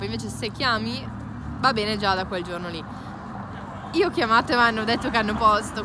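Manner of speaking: ordinary talk, nothing marked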